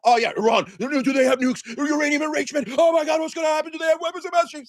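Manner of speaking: sarcastic pundit parody, climbing in pitch; mocking hawkish media. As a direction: ham up a breathless pundit fearmongering. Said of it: "Same media is like".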